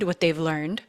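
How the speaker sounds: usual speaking voice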